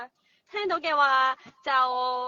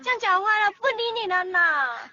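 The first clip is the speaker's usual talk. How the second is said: cutesy whiny pleading; 7 semitones higher, 4 dB louder than usual. Pretend. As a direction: pout and whine like a spoiled girl. Mimics partner's whiny Mandarin.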